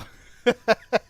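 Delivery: high, loud delighted laugh; loud burst of laughter. Real, not acted. Laughs after the shock.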